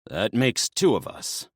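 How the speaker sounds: a touch of a sharp retort; mild snap. Acted. Dionysus snapping back at Naya, understated. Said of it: "Dionysus snapped".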